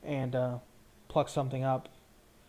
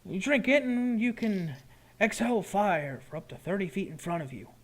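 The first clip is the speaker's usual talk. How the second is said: higher-pitched shopkeeper explaining his wares; potion seller; 6 semitones higher than usual. Acted.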